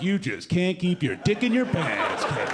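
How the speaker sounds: slow, smug teasing jab; mocking taunt. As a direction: needle him like you caught him out. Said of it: "I'm like".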